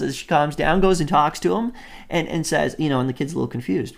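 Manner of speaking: normal talking voice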